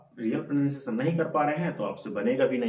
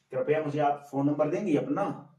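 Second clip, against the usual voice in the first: usual speaking voice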